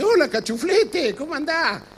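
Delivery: hammed-up high old-timer greeting; old man's greeting. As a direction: greet him like the old man at billiards. 83-year-old man's greeting quoted.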